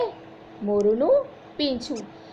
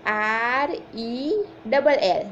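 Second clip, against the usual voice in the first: put-on slow, drawn-out spelling for children to repeat; slow classroom spelling drill; slowly, letter by letter; much slower than usual. Shown as a demonstration. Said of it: "મારી સાથે સાથે ધીમે ધીમે તમે બોલો".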